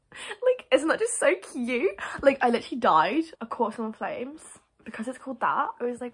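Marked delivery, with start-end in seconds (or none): 0.1-3.2 high excited squeal into gushing. Real, not acted. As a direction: squeal the first word high, then gush louder. Gushing over a cute scene.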